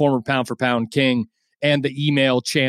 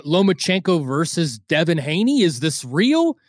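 usual speaking voice

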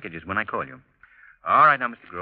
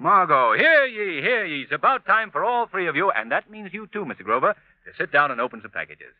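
higher, louder playful proclamation; mock town crier; 7 semitones higher, 4 dB louder than usual. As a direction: announce like a jolly herald. Announcing it's present time.

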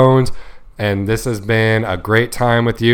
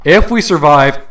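raised, higher-pitched shout in retelling; quick yell; 4 semitones higher, 6 dB louder than usual. Retold or imitated. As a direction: call it out as if mid-fall. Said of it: "I quickly yell at him, also saying".

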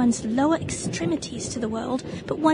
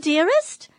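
normal talking voice

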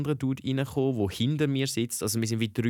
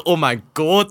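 louder, higher retold exclamation; startled recognition; 4 semitones higher, 8 dB louder, slower than usual. Realising he is from her old school, startled recognition. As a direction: act out sudden recognition.

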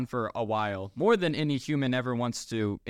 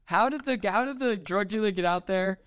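high-pitched incredulous rant; real comic exasperation; exasperated bafflement; 8 semitones higher, 4 dB louder than usual. Real, not acted. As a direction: plead for an explanation, stretching the words. Exasperated rant about a baffling scene.

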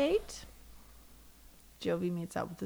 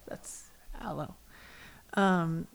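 normal talking voice

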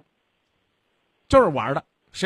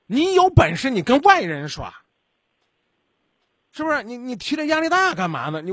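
angry scolding; 7 semitones higher, 3 dB louder than usual. Real, not acted. Host scolding a caller who bullies his girlfriend, angry. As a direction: tear into him for taking it out on her.

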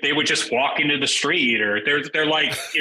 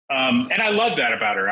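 ordinary talk, nothing marked

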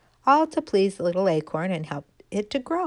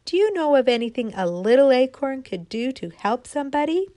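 acorn calling to the wind; 6 semitones higher, 4 dB louder than usual. Acted. Little acorn calling up to the north wind, pleading. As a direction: call up hopefully to the wind. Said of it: "she said".

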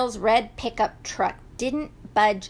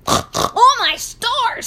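high, startled pig squeal; snort, then squeal it out; roly-poly pig in alarm; 12 semitones higher, 6 dB louder, slower than usual. A narrator's reading. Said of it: "squealed Roly Pig".

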